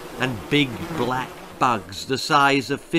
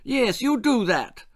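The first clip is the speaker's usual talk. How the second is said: the Queen; 9 semitones higher than usual. Acted. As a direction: announce yourself like royalty. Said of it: "said the Queen".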